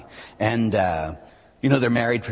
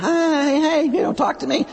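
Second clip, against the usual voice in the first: high, loud plea for attention; much higher, louder, a neglected spouse pleading; ignored spouse; 5 dB louder, slower than usual. Acted. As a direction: call out high and hurt to get noticed. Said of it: "there's your spouse sitting there going".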